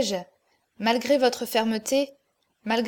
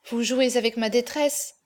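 plain reading voice

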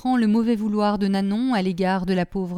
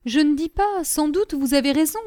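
higher, lively woman's voice; lightly voiced gossiping woman; conceding village woman; 6 semitones higher than usual. A narrator's reading. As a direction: concede the point with voice lifted.

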